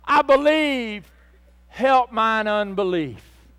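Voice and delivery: loud, slow imploring wail, easing at the end; acted anguished prayer; tearful cry out. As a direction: cry out to the Lord as a pleading father. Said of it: "cried out with tears and said".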